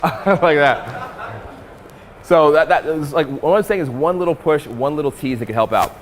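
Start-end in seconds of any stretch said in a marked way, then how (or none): 0.0-1.4 dismissive laugh. Retold or imitated. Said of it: "It's just like".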